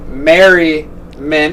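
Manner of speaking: "Merriment" stretched very slowly, syllable by syllable; drawn-out name. Shown as a demonstration. Host signing off with the show name, "Merriment" slowly drawn out. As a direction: draw out "Merriment" with a break before the last syllable.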